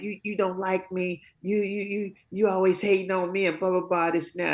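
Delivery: high, whiny mock protest from an upset teenager; mad son. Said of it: "He was mad".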